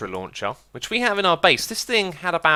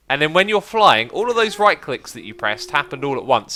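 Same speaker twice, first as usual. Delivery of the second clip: ordinary talk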